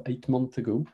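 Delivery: ordinary talk, nothing marked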